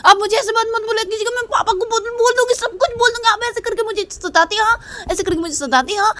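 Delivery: put-on tattle like a cross little girl; little sister threatening to tell papa, high and whiny. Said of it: "बोलती कि".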